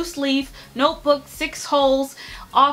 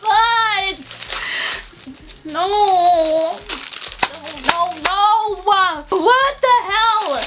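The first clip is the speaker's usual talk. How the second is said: shocked dismay; 4 semitones higher, much slower than usual. Real, not acted. Opening a disappointing package.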